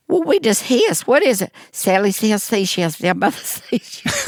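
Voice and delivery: high, hammed-up hissing lisp on every s; mock lisping voice. Teller mocking his own youthful lisp, high and hissy. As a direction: send up a lisping kid, exaggerated.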